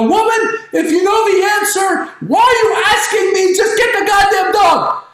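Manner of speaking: very high-pitched, fast-rising comic rant; exasperated husband yelling. Acted. Husband telling wife to get the dog, exasperated shouting. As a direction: blow up at the top of your voice. Acted quarrel, husband explodes.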